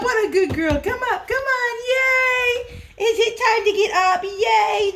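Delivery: high, happy dog-calling voice; 愛犬を呼ぶ高く弾んだ声; calling the dog excitedly. Real, not acted.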